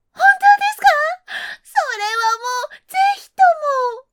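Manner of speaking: high, loud, fast eager plea; eager fox voice. Acted. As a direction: jump at the offer. Fox eagerly accepts trade.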